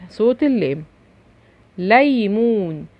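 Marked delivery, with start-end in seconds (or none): 1.8-2.9 ليمون said slowly with both syllables stretched; drawn-out demonstration. Shown as a demonstration. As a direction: sound out ليمون for young learners. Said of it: "ببين لك الصوت اهو". (نطق ليمون ببطء ومد المقاطع)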